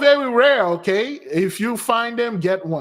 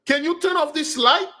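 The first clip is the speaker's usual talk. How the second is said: much higher, pleading shop owner; lightly played panicked shop owner, pitched way up; mimicking the pleading owner; 10 semitones higher than usual. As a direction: squeak it out like a startled woman begging. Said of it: "I heard the owner like".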